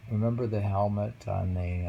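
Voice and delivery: ordinary talk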